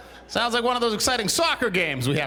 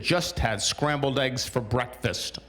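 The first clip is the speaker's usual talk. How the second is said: flat slow post reading; 5 semitones lower, slower than usual. Retold or imitated. Quoting a friend's post.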